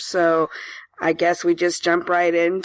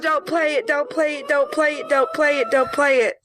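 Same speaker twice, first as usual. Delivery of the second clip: high, urgent repeated begging; pleading; 6 semitones higher than usual. Real, not acted. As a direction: beg him to stop, high-pitched. Begs partner not to play siren.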